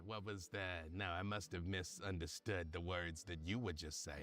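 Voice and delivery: a touch of a low character voice; Glasses. Acted. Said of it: "And she goes".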